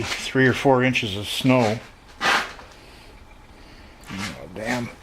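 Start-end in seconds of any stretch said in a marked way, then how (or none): 2.2-4.9 groaning with leg pain; effort grunt. Real, not acted. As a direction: grunt through sore legs. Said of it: "Sorry about the grunting and groaning".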